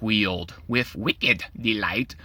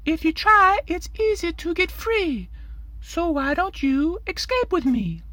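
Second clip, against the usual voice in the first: put-on much higher, lively boy's voice; eager Timothy. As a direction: coax him up to play, full of energy. Timothy urges the tomato boy.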